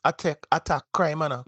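usual speaking voice

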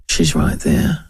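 a mild take-off of the sullen worker; flat grunt. Retold or imitated. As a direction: mumble it with zero interest. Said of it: "he's like".

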